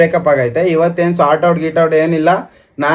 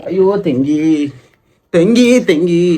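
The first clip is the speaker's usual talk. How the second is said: mock coaxing; much slower than usual. Teasingly calls her sister.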